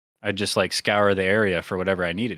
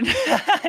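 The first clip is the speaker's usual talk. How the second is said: high bursting laugh. Real, not acted. Laughing at own notes.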